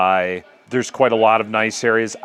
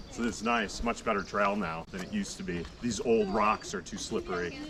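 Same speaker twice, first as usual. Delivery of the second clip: softer, hushed morning voice; quiet morning talk; 2 semitones lower, 9 dB quieter than usual. Real, not acted. Vlogger hushed by the hostel in the morning, quiet.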